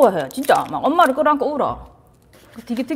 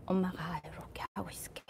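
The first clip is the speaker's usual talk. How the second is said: put-on soft whisper holding a child; a mother whispering while hugging; comforting whisper; 4 semitones lower, 21 dB quieter than usual. Shown as a demonstration. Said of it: "안아주면서".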